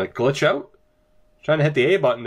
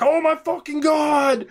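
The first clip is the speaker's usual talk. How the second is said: much higher hammed-up fake freakout; mock screaming; 13 semitones higher than usual. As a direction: imitate a hyped YouTuber screaming. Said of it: "sitting here and screaming like".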